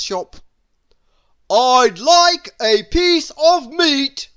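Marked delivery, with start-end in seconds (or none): 1.5-4.3 loud, high, slow bellow; booming shout. A narrator's reading. Mister Noisy bellowing at the baker, loud and slow. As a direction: boom it across the shop. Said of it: "Mister Noisy boomed".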